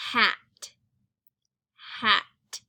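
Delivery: slow, clear hat, leaning on the final t; stretched-out hat. Shown as a demonstration. Teacher to kids, modeling hat clearly. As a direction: say hat carefully so the last sound is heard. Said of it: "what is the final sound in the word hat".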